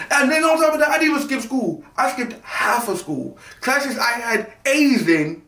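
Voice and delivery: high, rising indignation, slowing down; a high incredulous complaint; indignant. Real, not acted. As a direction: complain with growing disbelief.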